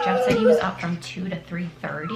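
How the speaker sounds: usual speaking voice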